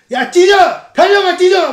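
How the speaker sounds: very high, loud yell; hammed-up mob yell; mob shouting. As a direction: scream it like a rioter. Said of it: "하면서 막".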